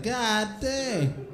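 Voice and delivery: high, slow drawn-out awe; amazed. Acted. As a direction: stretch it out, impressed. Awed reaction to tattoos.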